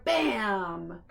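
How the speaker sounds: ordinary delivery, nothing marked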